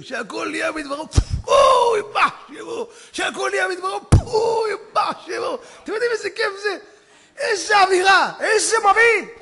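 high, loud cries of joy; preacher roaring with excitement; ecstatic shout. Real, not acted. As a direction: shout each blast with delight.

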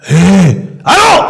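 slow, startled, just woken; startled groggy hello. Acted. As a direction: stumble awake surprised. Woodcutter jolted awake.

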